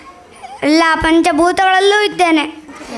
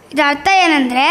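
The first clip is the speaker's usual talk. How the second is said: normal talking voice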